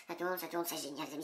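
low, soft deadpan mutter; muttered irony. Real, not acted. Vlogger to viewers about the camera mishaps, muttered irony. Grumbling at filming mishaps, ironic.

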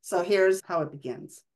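normal talking voice

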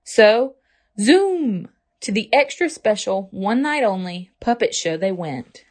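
usual speaking voice

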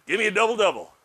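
mock customer ordering a double double in a growly accent. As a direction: bark your order at the counter with a put-on accent. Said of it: "in that accent or growly".

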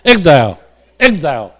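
"exile" drawn out slowly, each syllable clear; teacher slowly modelling "exile" for students; slow model reading. Shown as a demonstration. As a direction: say "exile" slowly so the class can copy. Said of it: "来，我读一遍".

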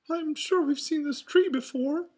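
high, loud falsetto mouse; Mickey Mouse. A narrator's reading. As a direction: talk on in a high cartoon voice. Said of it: "Mickey finally said".

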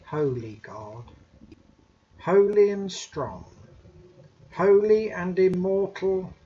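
usual speaking voice

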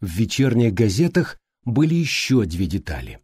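ordinary delivery, nothing marked

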